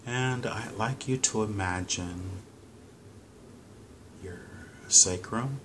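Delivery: slow, measured counting with long pauses; calm, paced meditation guide; guided breathing meditation. Real, not acted. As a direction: lead them through each breath unhurriedly, drawing out the instructions.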